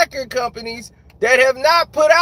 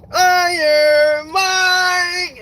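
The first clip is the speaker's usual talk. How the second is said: drawn-out sung chant of the name; mock background singer; 7 semitones higher, 3 dB louder, much slower than usual. Mocking the ring intro singer, drawn-out chant. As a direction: croon it slowly like a hype-track backing vocal.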